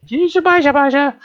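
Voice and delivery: very high cooing nonsense; baby talk. Acted.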